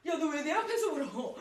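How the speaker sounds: loud, high-pitched mock protest; hammed-up alarm at someone crying; flustered retelling. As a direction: exclaim it high and loud, imitating a flustered colleague. Retold flustered colleague facing her tears.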